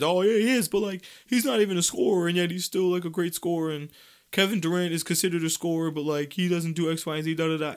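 high hedging voice imitating the crowd; mimicked naysayers. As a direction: rattle off everyone's excuses in a high, monotone run. Voices everyone's hedging answer.

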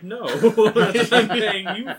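laughing quote. Retold or imitated. Driver refuses, laughing retelling.

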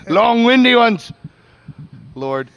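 loud, high theatrical cry; hammed-up reading. Reading Joyce's dirty letter aloud, loud and hammed-up. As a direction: belt it out with mock grandeur.

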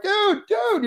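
high-pitched, elated buddy greeting; excited greeting. Retold or imitated. Friends spotting each other at Army Navy game, excited greeting. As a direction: call out in high-pitched surprise at spotting a pal. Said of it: "it was just like".

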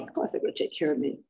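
breathy sarcastic whisper; mocking herself under her breath; whispered sarcasm. Real, not acted. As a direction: whisper it like a guilty confession. Sarcastic aside about herself.

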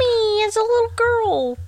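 playful singsong. Pretend. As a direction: stretch it out high and silly. Playful drawn-out teasing call.

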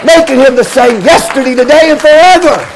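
chanting sermon climax, voice ringing over the organ; full-force preaching. Acted. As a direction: whoop it up like a revival preacher.

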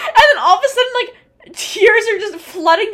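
high, loud, laughing through the words; laughing while telling. Real, not acted. Telling her friend how the tears came, laughing high and loud. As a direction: giggle your way into it.

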